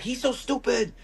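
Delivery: high-pitched tipsy broken English, laughing through it; broken English drunk woman. Retold or imitated. Drunk Korean woman mocking her husband, high laughing broken English. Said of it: "She spoke broken English".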